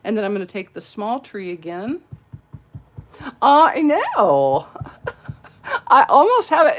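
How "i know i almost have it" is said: put-on high, loud Valley girl voice with laughs; Southern-tinged Valley girl impression. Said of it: "It's the Southern accent with the Valley".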